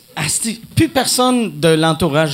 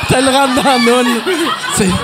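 laughing; 6 semitones higher, 4 dB louder than usual. Real, not acted. Laughing punchline, raised voice.

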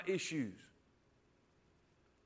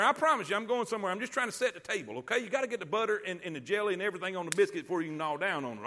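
ordinary talk, nothing marked

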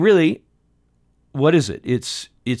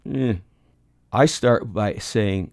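ordinary talk, nothing marked

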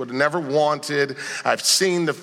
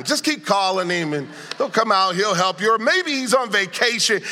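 higher-pitched taunting with fake encouragement; sarcastic mockery; 5 semitones higher than usual. Elijah taunting Baal's worn-out prophets, sarcastic. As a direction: play Elijah needling them with mock helpfulness. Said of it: "so you notice the sarcasm".

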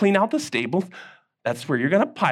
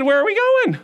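surprised Daniel; 12 semitones higher, 3 dB louder than usual. Acted.

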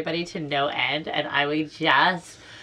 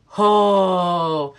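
low open "Ho"; 7 dB louder than usual. Shown as a demonstration. Birth teacher demoing the relaxing labor sound. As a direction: round open-mouthed "Ho", louder, relaxed. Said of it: "make your mouth like an O and go".

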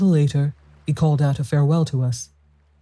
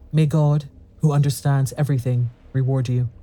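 ordinary delivery, nothing marked

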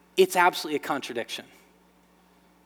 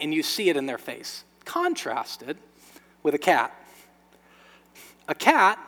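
ordinary talk, nothing marked

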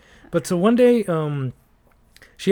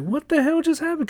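much higher, baffled exclamation; stunned disbelief; 11 semitones higher than usual. Retold or imitated. Clerk remembering his shock after the tenant walked out. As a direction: sound like the ground dropped out. Flabbergasted inner reaction.

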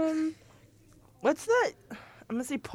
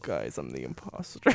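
very low, soft corpse mumble; 11 dB quieter than usual. Pretend. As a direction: drop deep and lifeless like a corpse. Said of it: "her corpse voice".

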